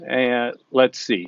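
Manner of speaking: slowing down. Real, not acted. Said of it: "okay, I'll slow down".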